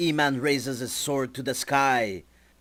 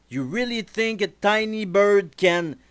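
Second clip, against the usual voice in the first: cocky taunt; 8 semitones higher, 7 dB louder than usual. Acted. Jazz taunts the birds.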